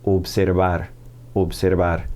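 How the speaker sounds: "Observar" said as a Spanish word; Spanish pronunciation. Shown as a demonstration. Teacher models the new verb for learners, Spanish pronunciation. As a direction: pronounce "Observar" with pure Spanish vowels and tapped r. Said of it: "pronounce it in Spanish".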